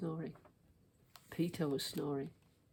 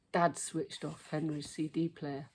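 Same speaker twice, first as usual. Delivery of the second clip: usual speaking voice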